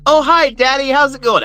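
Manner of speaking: put-on high, chirpy little-girl voice; lightly hammed-up daughter greeting her dad; mock sweet daughter. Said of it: "his daughter's like".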